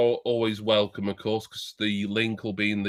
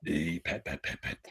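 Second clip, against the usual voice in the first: hushed, breathy Essex-lad character voice; Leroy Screwballs voice; 8 semitones lower, 10 dB quieter than usual. Pretend. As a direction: drop to a near-whisper as Leroy. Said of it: "Go on, Leroy".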